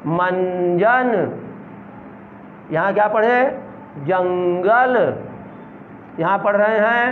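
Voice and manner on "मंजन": मंजन again drawn out slowly; slow second reading of मंजन; slow classroom reading of मंजन; slow repeat. Shown as a demonstration. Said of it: "teacher repeats word slowly".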